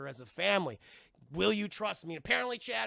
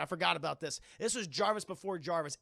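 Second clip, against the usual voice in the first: ordinary talk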